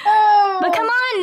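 drawn-out high protest; mock protest. Real, not acted. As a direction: stretch it out high.

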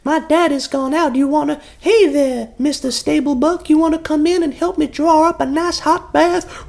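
high, flirty Southern belle; Deep South accent.

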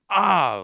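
pained cry. Retold or imitated. Hit in the eye.

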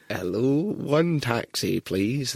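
put-on slow, drawn-out old man voice; hard-of-hearing old man. Prank caller ordering a taxi, as a hard-of-hearing old man. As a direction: stretch out each word like a deaf pensioner. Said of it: "pretend to be an old man who couldn't hear".

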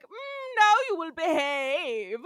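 loud, drawn-out mock scolding; Trelane. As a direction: lay down the law, high and slow. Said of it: "And Trelane's like".

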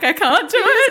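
high, loud, imitating a tearful breakdown; mock tearful wail. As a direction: play up the old despair, half laughing. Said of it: "see my eyes filling with tears".

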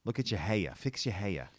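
Boston mum, hair said as heya in two syllables; Boston accent. Shown as a demonstration. Boston woman showing how hair becomes heya in her old accent. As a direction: nag about hair in broad Boston. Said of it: "words like hair have two syllables".

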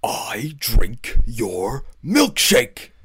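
very slow whispery build to a loud, high finish; hammed-up film villain. Podcaster quoting a favourite movie scene to co-host, theatrical and menacing. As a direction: draw out each word, then erupt on the last line. Acts out famous film line.

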